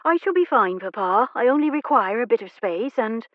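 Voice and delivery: high, theatrical plea to Papa; a daughter fussing dramatically with her fan; dramatic young lady. Acted. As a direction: overplay it like a fragile heroine. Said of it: "she began waving her fan dramatically".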